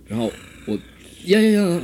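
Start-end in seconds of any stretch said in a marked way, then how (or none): 1.3-1.8 failed tongue trill. Retold or imitated. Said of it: "我怎么样都弹不起来".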